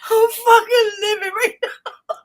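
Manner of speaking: high, loud, laughing through the words; can barely talk for laughing; laughing hard. Real, not acted. Host cracking up at the guest's rant, laughing loudly.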